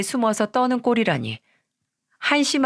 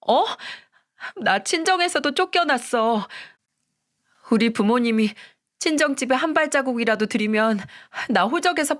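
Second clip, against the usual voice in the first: higher-pitched wife begging her husband; pleading wife; 5 semitones higher than usual. Acted. (애원하는 아내의 높은 목소리)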